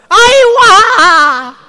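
falling infant cry, loud and high; German baby cry. Retold or imitated. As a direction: wail like a newborn, pitch dropping. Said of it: "It's high to low".

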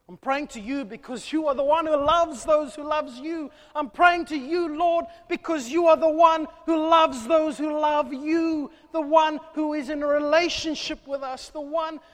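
loud, high impassioned preaching prayer; fervent prayer. Acted. Nehemiah crying out to God for his people, fervent loud prayer. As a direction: pour it out to God at full force.

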